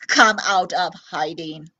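low menacing robot voice; robot coaxing. Acted. Robot luring the alien out, low and menacing. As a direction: coax them out, low and sly. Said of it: "it blinked".

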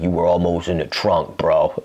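low, earnest retold warning; low-key serious, no joking; dead serious. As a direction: imitate a man calmly meaning his threat. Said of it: "he was dead serious when he said that".

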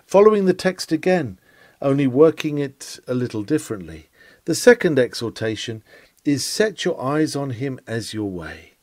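normal talking voice

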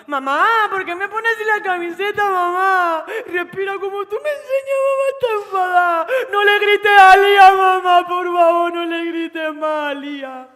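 put-on high, loud, tearful child pleading; a scared small son begging mom to stop; crying little boy. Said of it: "Y Nahuel". (niño pequeño llorando y suplicando a su madre)

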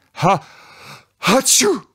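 big high sneeze building from a gasp; hammed-up cartoon sneeze. A narrator's reading. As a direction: act out a loud theatrical sneeze. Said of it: "Sinterklaas moest heel erg proesten".

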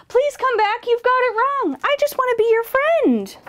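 high, earnest appeal; high-pitched storybook pleading; pleading young dragon. A narrator's reading. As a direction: call after them, begging in a high voice. Dragon pleads with fleeing bird.

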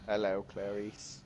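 low, slow, menacing hello; creepy slow greeting. Pretend. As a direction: drawl it like a creepy movie villain. Creepy greeting, movie villain nod.

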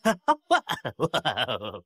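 a light, teasing laugh; amused chuckle. Acted. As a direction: chuckle at the kid's state. Amused at beaten-up student.

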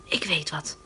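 sudden whispered idea; sudden thought. A narrator's reading. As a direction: hush it as an idea strikes. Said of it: "denkt Barbara plotseling".

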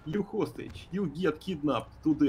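put-on thick foreign accent, clipped broken grammar; broken English accent. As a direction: play a gruff foreign kidnapper with broken English. Broken-English criminal role throughout.

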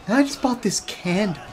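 lightly voiced laid-back Hollywood guy; Owen Wilson impression. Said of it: "I'm Owen Wilson".

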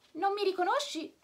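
a small creature calling out from the hedge; snail voice. Acted. Snail speaks to Pinocchio. (voce di lumaca acuta e squillante)

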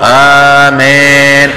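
mock congregation chanting آمين as long 'aa-mehn'; ياء ممالة. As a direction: answer آمين in the same wrong tune, ee opened to eh. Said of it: "بيعطوه على نفس النغم". (تقليد المأمومين في إمالة ياء آمين)

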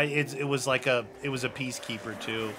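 ordinary delivery

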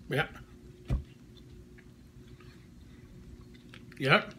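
chewing while talking; muffled closed-lip words; mouth full of candy. Real, not acted. Seen 0: Gummy goes in at -1.0; he chews with closed lips throughout.

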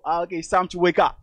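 usual speaking voice

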